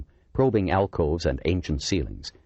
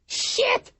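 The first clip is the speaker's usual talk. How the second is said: very high, panicked yelp; frantic cry. A narrator's reading. Officer crying out frantically as garbage rains down. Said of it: "came a frantic cry".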